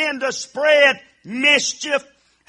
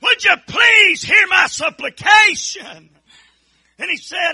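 ordinary talk

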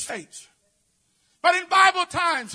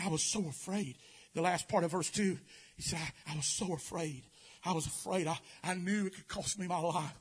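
hushed fearful confession; 9 semitones lower, 12 dB quieter than usual. Acted. Nehemiah's fear voiced softly.

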